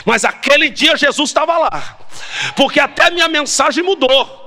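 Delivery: high-pitched, driving pulpit delivery; impassioned sermon cry; fervent preaching. Real, not acted. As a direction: preach it hard, stretching the key phrases. Preaches at full force.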